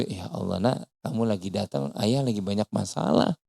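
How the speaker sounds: low, subdued mock sigh of a burdened parent; weary father's complaint. Father turning away his visiting child over his problems, mock weary. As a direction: lightly act a troubled dad, soft and low.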